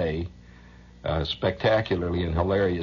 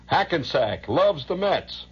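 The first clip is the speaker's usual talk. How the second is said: mock sign reading; 9 semitones higher, slower than usual.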